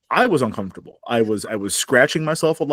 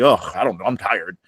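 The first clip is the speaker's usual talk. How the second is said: mock weary; 7 semitones lower than usual. Mimics groom's weary answer to in-laws.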